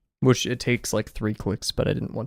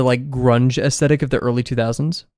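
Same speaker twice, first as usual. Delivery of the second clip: usual speaking voice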